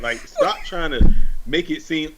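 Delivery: laughing through a scolding; cracking up while telling outsiders off. Real, not acted. Host laughing as she scolds outsiders over Norfolk.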